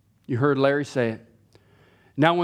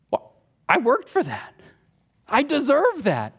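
much higher, indignant and possessive; entitled grumbling; 11 semitones higher than usual. Pretend. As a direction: claim it like a spoiled worker.

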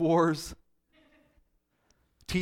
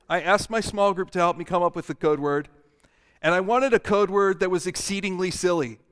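normal talking voice

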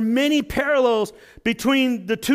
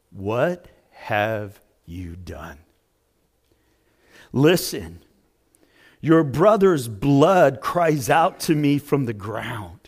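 much lower, slow and grave; voice of God; 8 semitones lower, slower than usual. Acted. The Lord confronting Cain with grave, low authority. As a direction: speak as a solemn judging God.

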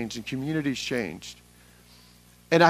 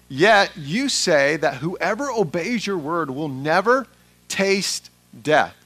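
loud, raised-pitch crowd accusation read aloud; forceful accusation; 3 semitones higher, 8 dB louder, slower than usual. Acted. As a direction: hurl the charge with slow, heavy emphasis. Reads the crowd's angry accusation aloud.

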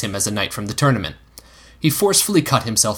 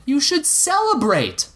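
put-on slow, higher coaxing boy; protesting boy; slower than usual. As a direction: coax him like a cheeky kid. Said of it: "Egg protested".

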